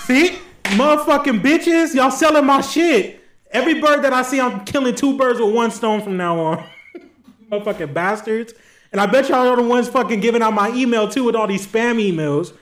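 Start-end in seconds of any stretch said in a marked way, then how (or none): 0.1-9.6 mock angry rant. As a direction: yell at the birds like they betrayed you.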